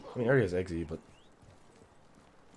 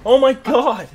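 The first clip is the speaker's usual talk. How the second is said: excited shout; 10 dB louder than usual. Real, not acted. Caster reacts to a kill.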